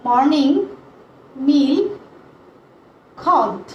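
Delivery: each word set apart by long pauses; word-by-word dictation. Shown as a demonstration. Teacher dictating question one to students, word by word.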